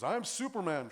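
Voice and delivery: put-on boy on the playground shouts his hero; playground boast. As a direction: play the boy claiming Superman. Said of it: "and he goes".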